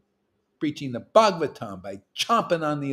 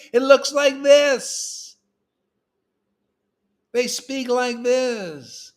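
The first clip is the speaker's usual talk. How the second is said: much higher, slow, otherworldly voice; freed person; 10 semitones higher, 4 dB louder, much slower than usual. Shown as a demonstration. Preacher demonstrating a person set free, high slow voice. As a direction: show how a liberated soul sounds. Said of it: "do you ever see a person set free".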